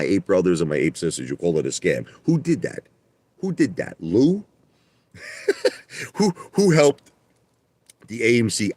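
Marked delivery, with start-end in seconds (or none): 2.2-8.7 high-pitched incredulous questioning; worked-up indignation. Real, not acted. Streamer challenging people who call it scam, indignant. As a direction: demand credit, voice leaping higher. Indignant boast to his critics.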